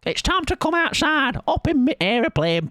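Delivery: lilting Come Outside presenter calling her dog; Auntie Mabel impression. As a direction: call out like a cheery children's show host. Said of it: "I think that's her accent, right?".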